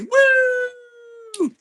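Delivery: a high, loud woo; excited whoop. Real, not acted. Streamer cheering confetti on screen, excited whoop.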